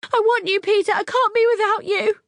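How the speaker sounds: very high, loud clinging cry; high desperate wail; frantic plea. Acted. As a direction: beg frantically. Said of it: "trying frantically to cling".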